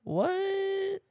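shocked high "What?"; surprised. Retold or imitated. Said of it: "And I'm like".

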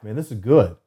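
usual speaking voice

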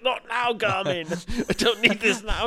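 high, loud mock-frantic protest; comically flustered, fending off a nagging gadget; exasperated. Presenter telling off his watch mid-pitch, high exasperated outburst. Retold snapping at his smartwatch.

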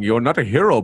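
ordinary delivery

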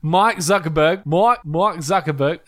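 slow Australian attempt sliding into British guard; badly faked Australian, turning British; Australian slipping British. Shown as a demonstration. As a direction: stretch it out in shaky Australian-British. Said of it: "like, British guard".

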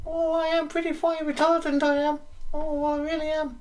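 put-on very high, loud old-lady falsetto; Mrs Doubtfire voice. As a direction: squeak it like a daft granny.

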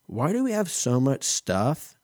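put-on high, small child's question; little kid voice. Little kid asking his mom in line, high and innocent. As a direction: ask it like a wide-eyed little kid. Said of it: "the little kid just goes".

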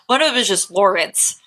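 ordinary delivery

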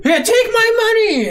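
very high, loud, laughing screech; panicking cyclops. Retold or imitated. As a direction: squeal in hammed-up terror at the hero. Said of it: "and he's like".

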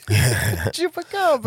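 high, drawn-out silly voice; goofy, slow high-pitched callout; playful mock call. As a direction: stretch it out high for a laugh. Joking callback to mutant cat.

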